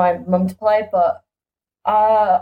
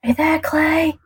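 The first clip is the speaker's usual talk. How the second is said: calling out; 7 semitones higher, much slower than usual. Real, not acted. Calling to silent co-host.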